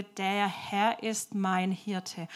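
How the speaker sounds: slow, measured verse recital; word by word. Acted. Wanderer teaching the boy a Bible verse, slowly. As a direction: give each word its own space, slowly. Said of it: "Und er sagt es genau so".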